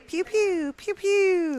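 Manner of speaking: high mock laser-gun noises; playful blaster sound effects; pew pew. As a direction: shoot with your mouth, high and loud.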